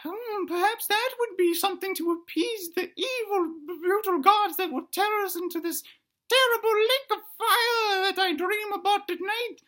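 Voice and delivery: high falsetto dino musing darkly; tiny dinosaur pondering in a very high voice; little dinosaur thinking aloud. Acted. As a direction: squeak out a dramatic soliloquy. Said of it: "now he's thinking and speaking at the same time".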